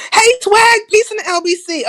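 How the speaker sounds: loud sing-song greeting. Real, not acted. Streamer hailing a viewer in chat, loud high greeting.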